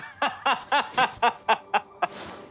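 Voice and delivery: high villain cackle, falling away; maniacal laugh. Acted. Abu Lulu laughs madly between threats. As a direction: let a mad laugh roll out. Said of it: "पागलों की तरह हंसते हुए कहता है".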